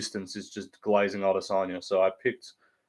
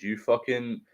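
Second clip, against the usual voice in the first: ordinary delivery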